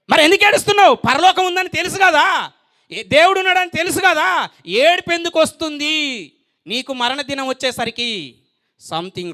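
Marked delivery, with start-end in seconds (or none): 0.1-6.3 high, insistent preacher's demand; raised-voice rhetorical scolding; forceful challenge. Acted. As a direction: throw the question out hard, pitched high. Same question repeated as a challenge.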